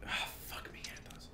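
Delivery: unvoiced whispered swear; whispered curse. Real, not acted. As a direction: hiss it under your breath. Muttered aside in game.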